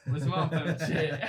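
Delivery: ordinary talk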